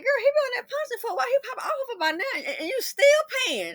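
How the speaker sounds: high, fast teasing friend; friend telling her off. Retold or imitated. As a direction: rattle it off like a friend scolding. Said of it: "She said".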